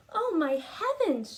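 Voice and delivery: mother's alarmed cry. Acted. Mother finding her daughter covered in stripes, shocked cry. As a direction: cry it out as a startled mom. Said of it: "she cried".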